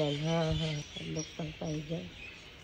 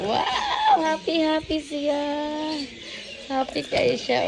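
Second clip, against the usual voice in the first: delighted wow; 7 semitones higher, slower than usual. Real, not acted. Delighted at happy animal.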